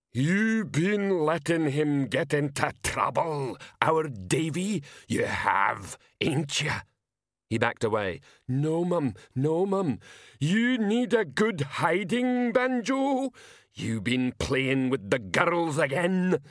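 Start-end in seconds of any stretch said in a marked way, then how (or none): 0.1-6.8 put-on high, slow menacing old woman; scary mother voice. A narrator's reading. Ma Lillywhite voiced. 8.5-10.0 higher, frightened begging son; pleading. A narrator's reading. Dave begs his mother. 10.4-16.4 put-on high, slow menacing old woman; scary mother voice. A narrator's reading. Ma Lillywhite voiced.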